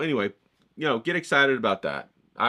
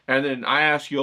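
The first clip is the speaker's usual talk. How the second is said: ordinary talk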